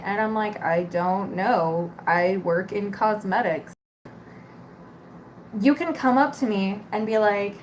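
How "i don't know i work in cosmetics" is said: slow, flat, tired retort; weary clerk brushing off customer; deadpan. Retold or imitated. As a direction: drag it out, done with them.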